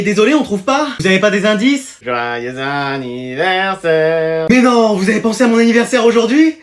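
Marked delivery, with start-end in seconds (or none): 2.0-4.5 slow drawn-out wish. Acted. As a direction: stretch "anniversaire" very slowly. Prof chante son anniversaire. (lentement, en traînant)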